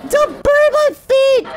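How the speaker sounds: high, loud mock squeal; panicked squeal. Squeals over lava.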